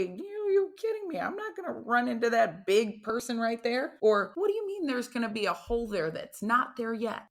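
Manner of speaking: high, incredulous protest; incredulous player. Retold or imitated. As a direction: play a stunned rookie refusing the hit. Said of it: "most humans look at and they say".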